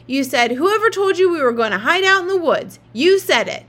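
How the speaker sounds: loud, high mocking repeat of her words; mocking yell. A narrator's reading. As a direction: throw her own words back at her, shouting. Kid mocks sister's words in quarrel.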